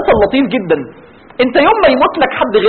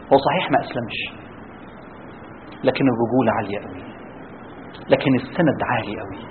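much lower and softer, choked; hushed emotional appeal; 11 semitones lower, 9 dB quieter than usual. Real, not acted. Preacher appealing to family elders, hushed and choked. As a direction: plead quietly, near tears.

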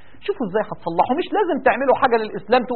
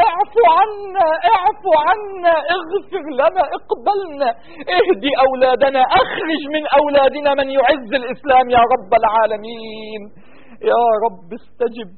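fervent, drawn-out Arabic du'a; impassioned supplication; 8 semitones higher, slower than usual. Real, not acted. Imam leading prayer for suffering brothers, fervent pleading. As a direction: plead with God, stretching the ends of phrases.

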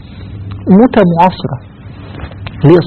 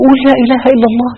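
urgent plea; 9 semitones higher, faster than usual. Retold or imitated. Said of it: "يقول له".

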